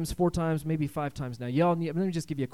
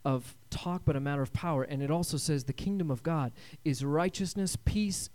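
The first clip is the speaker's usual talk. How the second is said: ordinary talk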